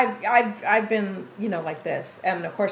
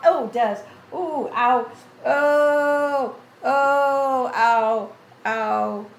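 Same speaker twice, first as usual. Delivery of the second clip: wincing; 6 semitones higher, 6 dB louder, much slower than usual. Real, not acted. Reacting to torn toenail.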